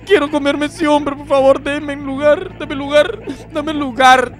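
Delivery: high, drawn-out begging; pleading whine. Acted. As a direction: whine to be let through. Begging to be let at someone.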